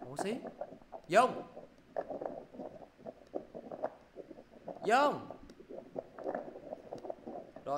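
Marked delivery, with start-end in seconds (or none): 1.1-5.3 raised, higher call to a student; name called out, pitched up. Real, not acted. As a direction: call out the name to someone not answering. Calling a student who isn't responding.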